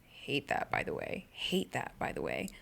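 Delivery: soft whispered mutter; whispered aside. Real, not acted. Vlogger grumbling to herself about her phone, whispering. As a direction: drop to a breathy whisper.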